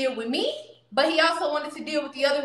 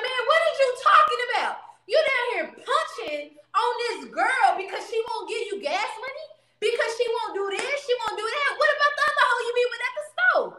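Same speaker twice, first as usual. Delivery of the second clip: much higher, sharp calling-out voice; indignant friend; 9 semitones higher than usual. Retold or imitated. The friend scolding the boyfriend for hitting her, high and incredulous. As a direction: call him out, high and incredulous. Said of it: "He was like".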